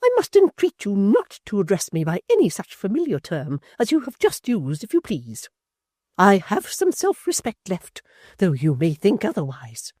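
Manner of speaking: high, loud indignant matron; a wounded lady turning cold and grand; high, loud offended dowager; Missus Chick, outraged. A narrator's reading.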